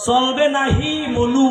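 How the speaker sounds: slow Barishal চলবে নাহি মনু with a high rise; Barishal dialect. Shown as a demonstration. Preacher repeats the Barishal phrase slowly for the crowd. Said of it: "Barishal ভাষা কি বলে".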